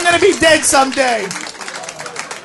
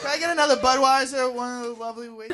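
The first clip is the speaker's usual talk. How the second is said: quick mumbled aside; 2 semitones lower, 9 dB quieter, much faster than usual. Acted. Quick aside ordering beer.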